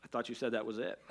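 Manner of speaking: muttered under the breath; mild frustration. Retold or imitated. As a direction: grumble it quietly to yourself. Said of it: "I'm kinda frustrated".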